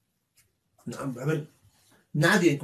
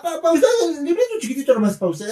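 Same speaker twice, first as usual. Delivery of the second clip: laughing mockery; 12 semitones higher, 6 dB louder than usual. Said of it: "mocking waiter, laughing".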